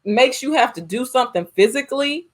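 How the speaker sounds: ordinary talk, nothing marked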